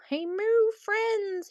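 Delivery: high, slow playful call; high sing-song greeting. Acted. Greeting viewers in cow voice.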